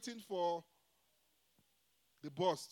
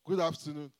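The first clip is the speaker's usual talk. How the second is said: stiff formal greeting; 3 semitones lower, 7 dB louder than usual. Retold or imitated. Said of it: "they will call you and say".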